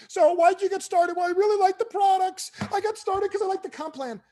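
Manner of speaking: squeaky over-the-top parody of a convention chat; mock convention interview. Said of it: "pretend there's a microphone".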